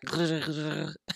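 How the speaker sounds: throaty rattle attempting a rolled R; failed rolled R. Shown as a demonstration. Vlogger showing viewers their bad rolled R, rattled noise. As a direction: try to trill an R and miss. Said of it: "My rolled R sound like".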